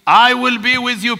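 high, forceful preacher's voice; impassioned preaching. Real, not acted. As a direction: build in driving, repeated phrases to the congregation.

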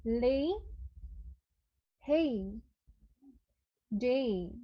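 লে, হেই, ডে each with a gliding ay ending; slow, separate, careful gliding vowels; repeating the words. Shown as a demonstration. Teacher repeats the words for students to copy. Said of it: "আমি আবার বলছি".

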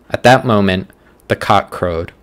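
ordinary talk

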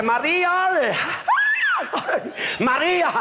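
high, drawn-out call to the wife; llamando a la mujer. Acted. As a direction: call out her name high, falling away. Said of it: "llama a la mujer". (llamada aguda a su mujer)

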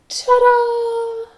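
high, drawn-out "ta-da"; ta-da flourish. Pretend. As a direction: present it with a high, held flourish. Presenting with a flourish.

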